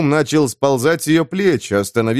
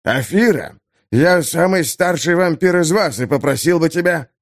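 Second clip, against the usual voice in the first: offended elder vampire; 6 semitones higher than usual. Acted.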